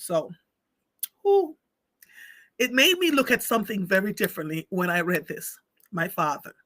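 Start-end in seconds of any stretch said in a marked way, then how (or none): 1.2-1.6 sharp high whew, fighting tears; high tearful exhale. Real, not acted. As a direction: blow out a breath to hold back tears. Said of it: "where the tears are coming from".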